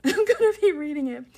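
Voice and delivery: high, loud burst through a laugh. Real, not acted. Vlogger forgot her own story's title, laughing. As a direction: laugh your way through the words.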